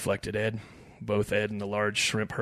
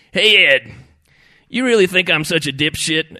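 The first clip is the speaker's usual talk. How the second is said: loud, raised challenge; confrontational; 7 semitones higher, 13 dB louder than usual. A narrator's reading. Fishing buddy challenges Ed over beer, confrontational. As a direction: call him out loudly.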